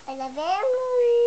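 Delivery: excited shout. Real, not acted. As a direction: shout it out happily. Child echoing mom at camera.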